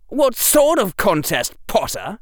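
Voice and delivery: high, loud, arch question; high-pitched mocking inquiry; loud drawl. A narrator's reading. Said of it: "in the loudest drawl Harry had ever heard".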